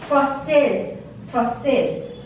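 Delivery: française said frahn-sez, ending on Z; silent final E. Shown as a demonstration. Teacher contrasting française with français for the class. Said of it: "this E is silent".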